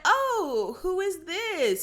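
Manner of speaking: high, drawn-out "oh" of feigned curiosity; mock surprise. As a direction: imitate a thrown ex sizing up the newcomer. Acts Gia meeting her rival.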